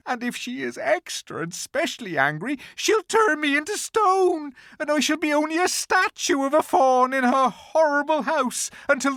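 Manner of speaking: high, tearful pleading faun voice; a frightened faun near tears; crying faun. A narrator's reading. Faun tells Lucy of the witch's punishment, tearful and high. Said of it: "beginning to cry again".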